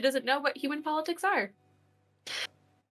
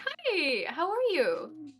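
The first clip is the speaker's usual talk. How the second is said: high, slow shy hello; a bashful, sing-song hi; flustered high greeting; 7 semitones higher, much slower than usual. Acted. Izzy greets Benny.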